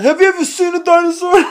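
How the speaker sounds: high, loud, funny comedian voice; exaggerated high-pitched celebrity impression; Tracy Morgan. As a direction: squawk it out as a comic impersonation. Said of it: "he talks really funny".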